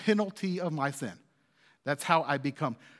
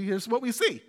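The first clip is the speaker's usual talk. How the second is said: voice cracking up an octave; 5 semitones higher than usual. Real, not acted. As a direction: voice cracks mid-sentence. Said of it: "Whoa, my voice just cracked".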